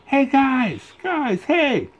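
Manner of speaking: very high call across the way. Acted. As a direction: call to friends far off. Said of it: "Woody called out".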